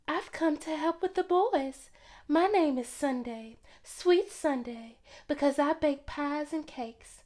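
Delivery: Sweet Sunday's light, sing-song voice; sweet birdlike voice. A narrator's reading. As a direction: chirp it like a sweet little bird. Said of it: "She talked like a sweet bird".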